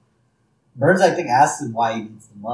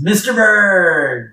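loud, long drawn-out announcement; higher, louder mock-ominous proclamation; dramatic reveal; 5 semitones higher, 5 dB louder, much slower than usual. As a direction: boom the villain's name, stretching it out.

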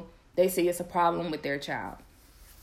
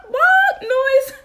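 high, loud mimicked bark; bark noise; 13 dB louder than usual. Mom imitating her son's barking cough, loud high bark.

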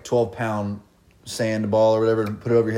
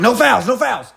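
high loud call across the court; coach calling out; 12 semitones higher, 6 dB louder, slower than usual. Retold or imitated. Coach shouting at the players during the drill. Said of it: "coach is like".

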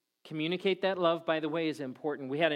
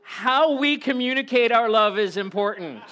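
loud, high mock-yelled moral; raised-voice preacher punchline; comic shouting; 7 semitones higher, 9 dB louder than usual. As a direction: shout the lesson like you're still yelling. Jokingly shouts the moral after yelling.

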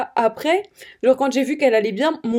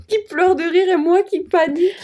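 voix rieuse, un peu aiguë; après un fou rire; 7 semitones higher than usual. Real, not acted. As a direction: rit encore en racontant. Laughing while telling. (reste de fou rire)

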